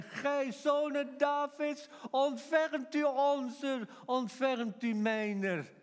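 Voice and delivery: loud, high, slowly drawn-out plea; pleading confession. Acted. Blind man begging the Son of David for help, pleading cry. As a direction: beg for mercy, stretching every word. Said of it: "En dan zijn belijdenis".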